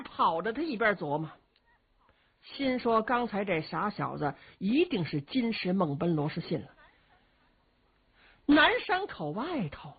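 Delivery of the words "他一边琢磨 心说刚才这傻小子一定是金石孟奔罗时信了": plain reading voice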